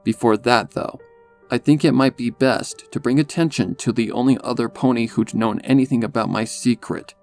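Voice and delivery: ordinary delivery, nothing marked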